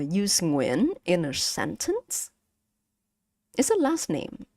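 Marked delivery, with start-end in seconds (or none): none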